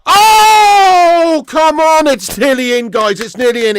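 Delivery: loud, high shout at a near miss; excited shout. Real, not acted. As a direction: yell with real excitement, then settle.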